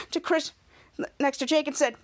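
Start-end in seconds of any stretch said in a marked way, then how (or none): none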